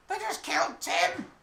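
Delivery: high-pitched, panicked goblin shriek; screaming goblin. Acted. Goblin screaming to the others about a killing, high-pitched shriek.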